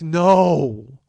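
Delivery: loud, drawn-out collective groan; dismayed crowd. Retold or imitated. Studio crowd sees the bankrupt hit, loud dismay. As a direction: imitate an audience crying out in dismay. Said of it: "the air goes out of the room".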